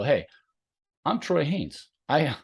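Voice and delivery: hammed-up boastful intro, pitched high; mock bragging. Coach mocking a bragging intro to his new athlete, hammed-up. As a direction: play a puffed-up show-off introducing himself. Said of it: "I don't just go".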